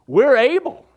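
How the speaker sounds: high, loud mocking echo of the disciples' brag; mocking boast. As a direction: throw their brag back in a shrill, loud parody.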